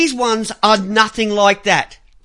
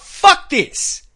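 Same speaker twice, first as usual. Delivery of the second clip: imagined outburst; 7 semitones higher than usual. Retold or imitated. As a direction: punch it out hard. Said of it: "Instead of coming out and saying".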